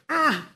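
groggy, annoyed "ugh"; grumpy waking groan. Acted. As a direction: groan like someone woken too soon. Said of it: "you're grumpy".

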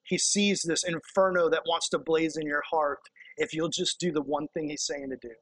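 usual speaking voice

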